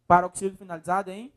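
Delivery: ordinary talk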